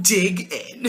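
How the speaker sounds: mimicked pep-talk catchphrase, on the edge of laughter; mocking Olivia's talk. Podcaster sending up Olivia's way of talking, amused mockery. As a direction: tease her delivery. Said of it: "what is with Olivia and her talk".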